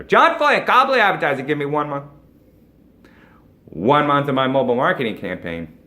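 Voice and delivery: high, loud promo read over music; bright ad-read intro, loud and high; upbeat ad announcer. Acted. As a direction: sell it like an opening commercial pitch. Closing ad sign-off.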